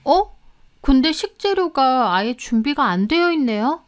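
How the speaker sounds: put-on puzzled young housekeeper, voice lifted; surprised. A narrator's reading. Housekeeper finds no ingredients ready, surprised. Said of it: "송혜연이 좀 놀라더라고요".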